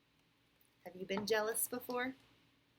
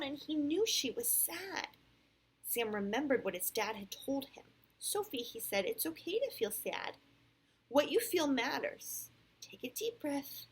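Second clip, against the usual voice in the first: clear, animated read-aloud; storybook voice with lively rises and falls; picture-book narration; 7 semitones higher, 7 dB louder than usual. A narrator's reading. Parent reads Sam's story to a child, animated storytelling.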